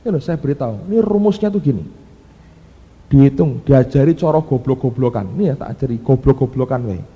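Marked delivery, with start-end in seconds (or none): none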